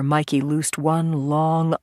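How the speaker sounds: ordinary delivery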